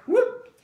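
high, loud "whoop"; quick imitated swoosh of wine going down; comic high-pitched whoop; gulping sound effect.

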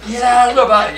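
high, loud shout. Retold or imitated. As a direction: order everyone off the bus. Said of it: "we're going".

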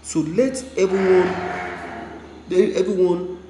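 loud, high, forceful call; heated shout. Real, not acted. Vlogger demanding viewers accept the protest, loud.

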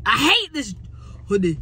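high, loud burst of irritation; annoyed outburst. Real, not acted. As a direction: blurt it out in exasperation. Outburst about hoodie, then apologises.

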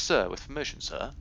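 normal talking voice

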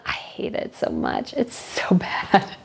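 hopeless, laughing whisper of disgust; exasperated whisper. Real, not acted. Vlogger whispering her disgust at Catherine's departure dress. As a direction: whisper it, with a laugh breaking through.

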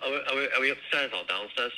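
ordinary talk

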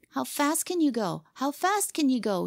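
put-on high, insistent boyish challenge; demanding. A narrator's reading. Boy turns suddenly upon his rival, demanding. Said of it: "he demanded".

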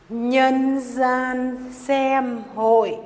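slow, stretched-out chant of the move name; drawn-out call. Acted. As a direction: draw each word out like a chant. Names the dance move aloud.

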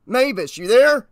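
high, loud call into the radio; urgent radio call. Acted. Officer calling his night dispatcher after a crash, urgent. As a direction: call out to raise someone who may be dozing.

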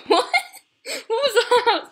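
high, loud laughing disbelief; laughing shock. Real, not acted. Gamer reacting to a friend in the game, laughing in shock. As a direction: blurt it out through a laugh.

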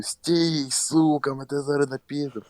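retold threatening yell; mock yell. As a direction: shout after him to stop. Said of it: "кричу".

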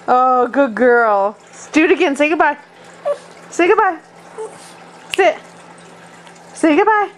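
high, sing-song coaxing of a dog; high pet talk. Real, not acted.

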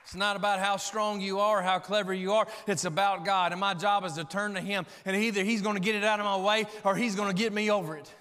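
loud, emphatic sermon delivery; forceful preaching. Acted. Preacher telling congregation to rely on God, forceful preaching.

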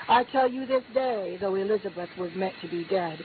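ordinary talk, nothing marked

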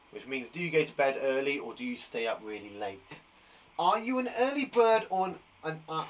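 usual speaking voice

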